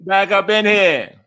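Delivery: slow, loud, high crowing; triumphant boasting. Retold or imitated. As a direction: draw it out like a victorious return. Caps the comeback routine.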